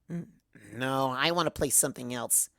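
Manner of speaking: put-on drunk Twilight. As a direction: slur through it, hurt and pretending not to care.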